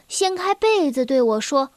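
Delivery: usual speaking voice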